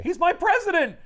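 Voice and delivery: mock-hysterical high, loud cry; Talbot's meltdown. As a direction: protest like a fanboy falling apart. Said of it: "Talbot is meltdown about the president".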